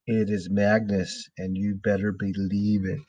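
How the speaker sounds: eerie, deliberate delivery; ghostly chalk message. A narrator's reading. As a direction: read it out as a measured ghostly threat. Chalk message repeated for the board.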